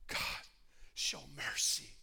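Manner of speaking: humble prayer. Shown as a demonstration. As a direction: pray it gently, pleading.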